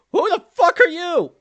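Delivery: high, loud yell at a stranger; startled yell. Acted. Startled character yelling at the stranger who appeared. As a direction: bellow it in alarm.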